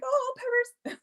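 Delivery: delighted. Retold or imitated. Host recalling a cat in a pattern, high gleeful squeal. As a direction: coo it with amused delight. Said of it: "I was like".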